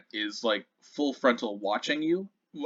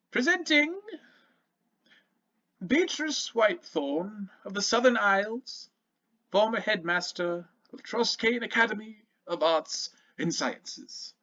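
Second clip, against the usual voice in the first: formal announcer; 10 semitones higher than usual. Acted. Doorman presenting the former headmaster at the door, formal announcer. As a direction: herald a guest grandly, pitched up high.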